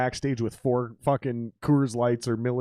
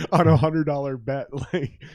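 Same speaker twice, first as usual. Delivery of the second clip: laughing; 3 semitones higher than usual. Real, not acted. Laughing through the punchline.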